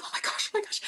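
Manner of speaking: retold breathless, voiceless shock; stunned whisper. Mother retelling her shock at the test result, stunned whisper. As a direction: whisper it in mock overwhelmed disbelief.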